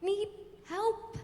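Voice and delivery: put-on high, halting, timid girl's voice; mock an unsure helper, pausing between words; hesitant. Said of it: "and hesitantly takes your arm".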